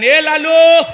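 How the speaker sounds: high, loud call to the dog. Retold or imitated. Owner calling his dog Lalu, loud high call. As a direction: call out across the yard.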